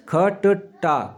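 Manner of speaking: ख ट टा spelled out very slowly; teacher spelling ख ट टा, very slowly; syllable breakdown. Shown as a demonstration. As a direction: split ख ट टा apart slowly. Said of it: "क्या बनते हैं?".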